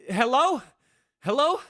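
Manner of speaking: loud, high hello into the phone; checking the line in a panic; anxious hello. Acted.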